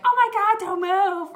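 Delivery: high, loud panicked shriek; hammed-up frantic yell; mock scream. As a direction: scream it as an exaggerated warning. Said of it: "it didn't scream at me".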